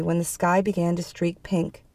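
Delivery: plain reading voice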